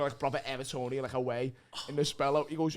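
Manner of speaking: normal talking voice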